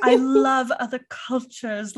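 high, slow put-on socialite; gushing, drawn-out mock grandeur; Dorit. Host mocking Dorit's worldly act, loud and affected. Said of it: "she is very, like".

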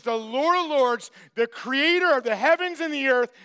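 ordinary delivery

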